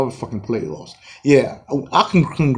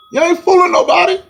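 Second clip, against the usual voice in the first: much higher, mocking taunt; 14 semitones higher, 4 dB louder than usual. Real, not acted. Taunting Nets fans in a high mocking voice. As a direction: throw it up high at them. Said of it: "repeated taunt".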